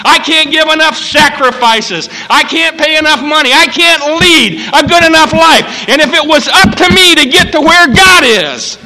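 high, shouted pulpit plea; full-force preaching. Acted. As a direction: cry it out to the whole congregation.

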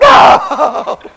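big burst of laughter; loud laugh. Real, not acted. Preacher to church, loud laugh at the scare.

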